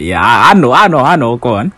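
high, loud eager I know; excited. Real, not acted. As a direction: burst out knowingly. Excited recognition of edges.

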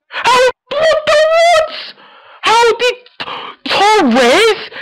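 shocked disbelief. Real, not acted. Opponent scores an unlikely goal.

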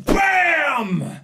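high shouted "bam"; high, drawn-out impact effect; imitated explosion noise. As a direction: punch out a long cartoon bang. Sound effects while waiting.

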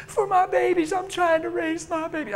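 a hint of a desperate woman; lightly voiced desperate mother. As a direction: plead for her children. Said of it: "Tell me why".

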